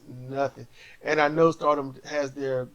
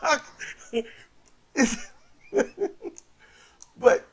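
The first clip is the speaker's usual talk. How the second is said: usual speaking voice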